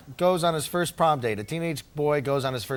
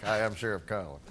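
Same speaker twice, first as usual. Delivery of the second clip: low, soft plain sheriff intro; flat title intro; 8 semitones lower, 8 dB quieter than usual. Retold or imitated. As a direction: say it as a bland introduction. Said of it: "I like how they give you the titles, like".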